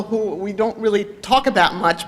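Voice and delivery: normal talking voice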